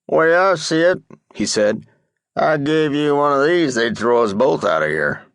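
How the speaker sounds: a hint of a crusty cowboy griping; ornery old cowboy. A narrator's reading. Old cowboy grumbles to Dupre about the hospital, lightly voiced crusty gripe. Booger Tom with cigarette.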